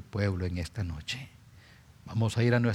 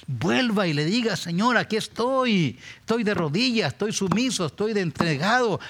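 high, louder prayer cry; fervent pleading prayer; 9 semitones higher, 3 dB louder than usual. Acted. Preacher voicing a kneeling believer praying, high fervent cry. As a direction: surrender to the Lord with passion.

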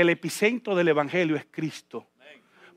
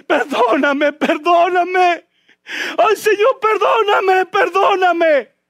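higher, louder, crying plea for forgiveness; acted tearful pleading; 10 semitones higher, 5 dB louder, much slower than usual. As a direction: beg forgiveness through sobs. Sinful woman voiced begging. (súplica llorosa actuada)

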